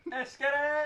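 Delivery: hyped shout. Real, not acted. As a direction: pump the table up. Hype repeated louder.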